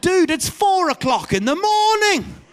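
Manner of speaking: hammed-up exasperation, pitch soaring; exasperated father. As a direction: plead with a child who woke you far too early. Acts exasperated father to son.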